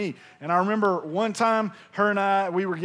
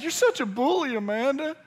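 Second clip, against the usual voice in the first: mock hurt; 6 semitones higher than usual. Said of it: "I said".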